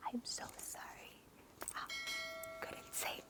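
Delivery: imitating a lost voice, barely audible; voiceless whisper. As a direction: mouth it with almost no sound, just breath. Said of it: "I was voiceless at this point".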